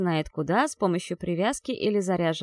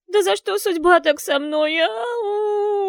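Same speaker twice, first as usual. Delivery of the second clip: high, loud, wailing through sobs; gurgling sobs; 11 semitones higher, 7 dB louder, slower than usual. Acted. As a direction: voice bubbling with rising tears. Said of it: "Её голос булькал от подступающих к груди рыданий".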